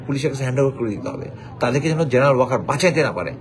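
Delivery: ordinary delivery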